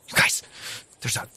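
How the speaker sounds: breathless startled whisper; gasping whisper. Pretend. Host pretending to spot eyes in the dark, gasping whisper. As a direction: gasp then hush the words.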